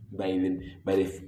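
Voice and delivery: usual speaking voice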